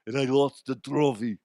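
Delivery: goofy German-accented action-star impression; Arnold. Confessing he lost the trophy, action-star impression. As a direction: play it as a mock Austrian strongman confessing. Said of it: "that's, that's Arnold".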